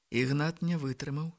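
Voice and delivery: usual speaking voice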